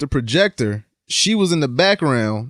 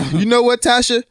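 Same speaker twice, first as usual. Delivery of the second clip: mock-serious voice pitched up and louder at first; Tommy; 4 semitones higher, 4 dB louder than usual. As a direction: imitate someone dead serious, hammed up. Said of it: "in a serious voice and go up an octave".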